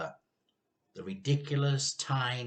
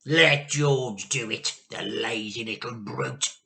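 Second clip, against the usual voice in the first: Grandma snarling; 6 dB louder than usual. A narrator's reading. Grandma sneers that George should fetch it. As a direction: sneer at the lazy boy. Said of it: "Grandma shouted".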